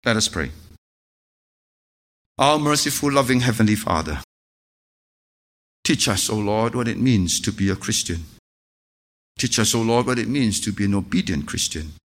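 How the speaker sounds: low, soft, reverent prayer voice. Acted. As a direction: pray humbly for the congregation. Leads closing prayer.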